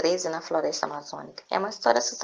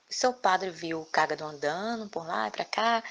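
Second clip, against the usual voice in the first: usual speaking voice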